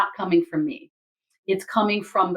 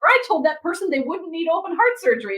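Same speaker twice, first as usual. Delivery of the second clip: mock panic; 11 semitones higher, 3 dB louder than usual. Mock panicked self-quote.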